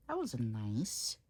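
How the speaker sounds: plain reading voice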